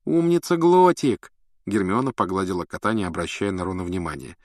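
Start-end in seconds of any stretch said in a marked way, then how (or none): 0.1-1.3 fond praise. A narrator's reading. Hermione praising the cat.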